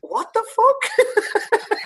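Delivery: very high, slow, laughing what the fuck; mock shock. Recalling meeting a friend's dad, laughing mock shock. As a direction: squeal it out in laughing disbelief. Retelling his shocked reaction.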